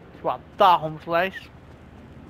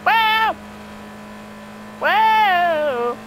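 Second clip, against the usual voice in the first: startled yelps; 11 semitones higher, much slower than usual. Real, not acted. Gamer reacting to getting hit in play, startled yelps. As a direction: yelp as if you just got hit. Cries out at hits in the game.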